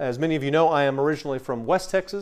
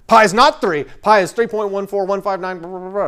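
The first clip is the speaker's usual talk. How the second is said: loud, higher-pitched protest; mock outraged objection; 6 semitones higher, 7 dB louder than usual. Math nerd protesting a Bible number, mock outrage. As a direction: object loudly, reeling off into blahs.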